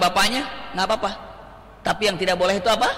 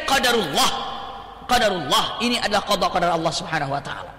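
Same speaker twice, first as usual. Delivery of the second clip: ordinary talk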